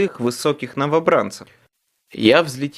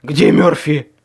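loud, winded rasping question; hoarse rasp; 6 dB louder, slower than usual. A narrator's reading. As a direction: rasp it out of breath. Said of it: "прохрипел я".

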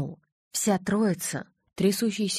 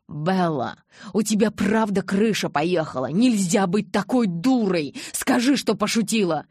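shouting at her; 6 dB louder than usual. Acted. Jacob shakes and yells at her.

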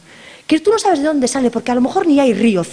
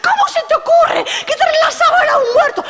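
very high, loud laughing shriek; horrified shout; 4 dB louder than usual. Acted. Friends shriek at the hostess, very high horrified shout. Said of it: "Gritaron todas".

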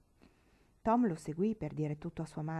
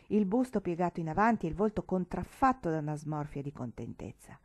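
ordinary delivery

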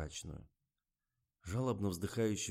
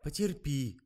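mild, higher-pitched coaxing; 7 semitones higher, 4 dB louder, slower than usual. A narrator's reading. Liza talks Katya into bearing it, coaxing. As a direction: gently urge her to put up with it. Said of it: "уговаривала её Лиза".

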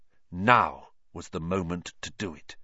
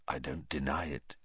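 soft, low admission; very quietly; 8 semitones lower, 11 dB quieter than usual. A narrator's reading. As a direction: say it almost under breath. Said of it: "very quietly".

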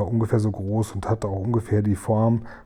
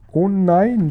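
high, loud moan of dismay; wailing; 9 semitones higher, 6 dB louder than usual. A narrator's reading. Sophiechen dreads the queen's nightmare, high loud wail. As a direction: wail it as a pleading lament. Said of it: "jammerte Sophiechen".

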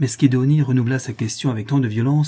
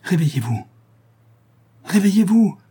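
urgent waking call, second cry jumping much higher and louder; forceful call; 8 semitones higher, 4 dB louder than usual. A narrator's reading. Monk waking the sleeping girl beside her bed, forceful call. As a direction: call out to rouse a sleeper, building. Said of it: "il l'appela d'une voix forte".

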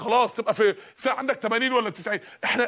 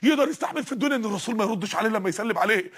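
normal talking voice

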